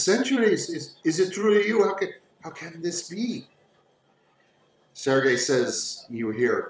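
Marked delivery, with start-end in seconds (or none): 0.0-3.5 high, halting disbelief; astonished young woman. Acted. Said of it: "She says".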